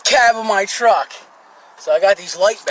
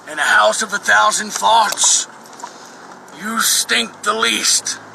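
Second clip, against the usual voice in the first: normal talking voice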